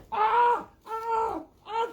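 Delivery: high panicked screams; pretend screams. Playing a swimmer attacked by Nessie, screaming. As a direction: shriek as if attacked.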